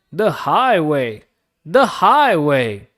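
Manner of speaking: slow, loud, swelling proclamation; grand boast. A narrator's reading. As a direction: stretch it out proudly and loud. Rat boasts, drawn out.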